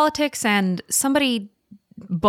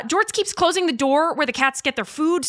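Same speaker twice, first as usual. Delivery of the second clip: usual speaking voice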